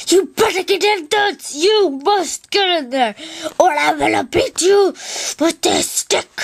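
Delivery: high-pitched furious boss rant; angry Benson. Acted. Benson yelling at a worker to get in there, high and furious. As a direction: threaten him like a manic park manager. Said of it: "an angry person like Benson from Regular Show".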